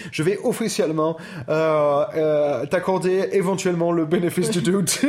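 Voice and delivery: put-on French with a laboured English accent. Granting co-host the benefit of the doubt, English accent.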